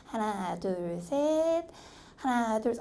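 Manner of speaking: softly drawn-out count; slow calm counting. Shown as a demonstration. Teacher counting slowly for the driving assistant. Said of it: "내가 지금 얘기하는 속도대로 차분히".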